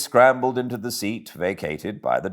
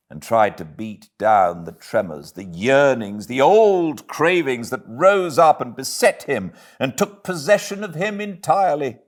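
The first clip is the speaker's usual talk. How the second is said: usual speaking voice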